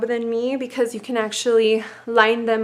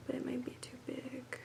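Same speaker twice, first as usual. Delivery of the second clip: whispered aside to herself; whispering; 2 semitones higher, 20 dB quieter than usual. Real, not acted. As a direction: breathe it out under your breath. Muttering to herself mid-task.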